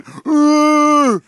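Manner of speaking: loud, high sustained hum; Kenny. Retold or imitated. Host imitating Kenny in the imagined picture, loud high hum. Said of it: "and Kenny being".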